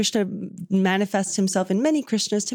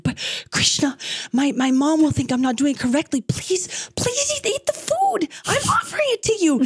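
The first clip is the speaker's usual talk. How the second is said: very high, hammed-up pleading; exasperated boy; 8 semitones higher than usual. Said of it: "So the boy gets exasperated".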